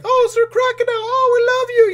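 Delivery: very high, loud fangirl gush; adoring fans. Retold or imitated. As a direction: squeal it like a swooning crowd. Voices adoring crowd greeting Crocodile.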